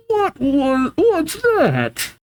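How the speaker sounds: very high, bewildered squeal; startled. Acted. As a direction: act out shocked confusion, voice way up. Aaron startled by the blast.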